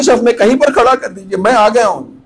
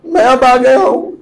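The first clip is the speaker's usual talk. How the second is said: tearful; 6 semitones higher than usual. Real, not acted. Preacher weeping as he tells his master he has come. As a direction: voice breaking with tears. Voice breaks addressing the Imam.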